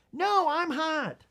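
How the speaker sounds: put-on high, slow kid's whine; protesting child. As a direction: play a stubborn child, stretching the words high. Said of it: "The child".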